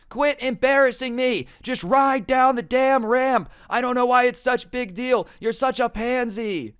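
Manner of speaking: high, loud imitation of a furious dad; screaming dad. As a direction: yell it like an angry parent across the park. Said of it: "his dad start screaming".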